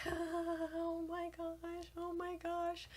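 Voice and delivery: soft, slow, high panicked murmur; panicked whisper. Retold or imitated. Mother in labor, panicking softly in the moment. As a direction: breathe it out in disbelief, drawn out. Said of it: "And I'm like".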